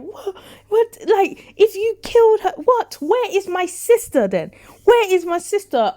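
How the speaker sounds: high loud frantic questions; loud high panicked pleading. Acted. Sonia panicking, acted out.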